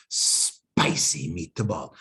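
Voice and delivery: slow, low mock Italian accent. Lecturer joking about the special sauce, mock Italian accent. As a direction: savor it like a hammed-up Italian chef in a mock Italian accent. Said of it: "no more Italian accent".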